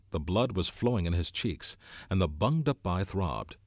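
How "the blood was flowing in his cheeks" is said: ordinary delivery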